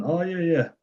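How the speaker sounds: high, falling musing "oh"; imitated dawning agreement. Patient mulling over his therapist's point, musing agreement. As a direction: lightly act out coming round to an idea. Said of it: "Marty's kind of thinking".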